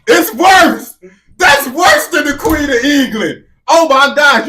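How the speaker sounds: loud, high-pitched disbelief; appalled outburst. Real, not acted. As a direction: cry out at how bad it is. Outburst reacting to a clip just played.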